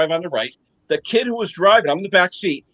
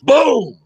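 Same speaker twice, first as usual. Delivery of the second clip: imitated boom; 7 semitones higher, 5 dB louder than usual. Retold crash noise.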